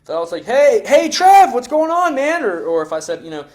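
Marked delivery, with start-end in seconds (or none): 0.4-2.4 put-on high, loud and slow, like greeting an old buddy; friendly familiar greeting. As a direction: call out to a pal you haven't seen in a while. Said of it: "I sound like I know the guy".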